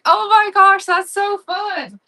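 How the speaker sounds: high gush, loud then drawn out; acted squeal, slowing on the last words; thrilled gushing. Retelling her thrilled reaction.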